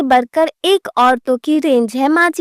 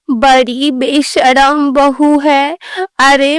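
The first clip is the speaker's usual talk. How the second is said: ordinary talk, nothing marked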